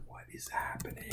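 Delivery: whispered, baffled aside; whispered bewilderment. Retold or imitated. Confused reply to girl's email.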